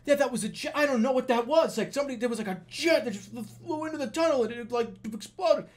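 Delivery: high, frantic mock panic; acted freak-out. Mother and sister reporting the jet crash, hammed-up panic. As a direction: jabber it like frightened relatives. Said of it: "freaking out. They're going".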